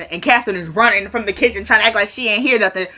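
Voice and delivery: ordinary talk, nothing marked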